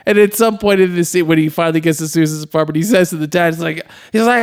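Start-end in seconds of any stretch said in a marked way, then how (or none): none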